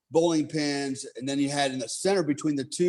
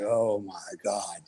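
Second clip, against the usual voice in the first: dismayed groan; 6 semitones lower, slower than usual. Retold or imitated. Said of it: "And I'm like".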